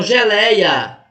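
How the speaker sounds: "geleia" slowed, with a wide open éi; open diphthong. Shown as a demonstration. Teacher asking students to notice the diphthong, "geleia" with open éi. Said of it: "percebam que é um ditongo aberto aqui".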